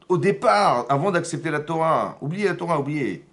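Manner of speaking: loud, high, driving sermon voice; impassioned rabbi hammering home his point; fervent preaching. Acted. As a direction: preach it hard to the room, pushing every phrase. Preaching with rising force.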